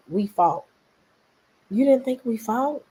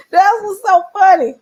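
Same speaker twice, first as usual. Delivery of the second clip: laughing; 11 semitones higher, 9 dB louder than usual. Real, not acted. Still laughing at the comment.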